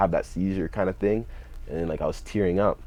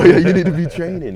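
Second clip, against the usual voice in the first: loud, high mock scolding; angry brother; 11 semitones higher, 11 dB louder than usual. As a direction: yell it like a fed-up brother. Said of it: "my brother was so pissed".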